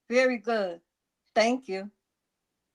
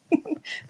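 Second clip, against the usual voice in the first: breathy laugh; 3 dB quieter than usual. Real, not acted. Quiet laugh while calling.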